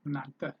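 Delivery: እናንተ with a short neutral "tuh" ending; correct pronunciation demo. Shown as a demonstration. Teacher repeats the right form for learners, pronunciation demo. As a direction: repeat እናንተ as "nan-tuh", final vowel reduced.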